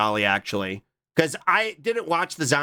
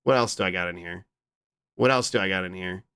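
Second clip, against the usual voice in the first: ordinary delivery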